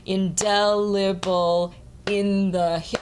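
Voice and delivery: slow beat-by-beat "indelible", then a heavy "in the"; drawn-out metrical reading, DEL and "in" both weighted; in on third beat. Shown as a demonstration. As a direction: chant "indelible in the" evenly, IN too strong. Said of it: "I put in the on the third beat".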